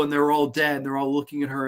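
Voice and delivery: ordinary delivery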